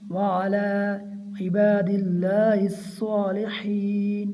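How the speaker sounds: slow, measured chant, phrase by phrase; tajweed recitation. Shown as a demonstration. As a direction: recite for learners to repeat, long vowels held. Recites tashahhud for learners. (ठहर-ठहर कर तिलावत)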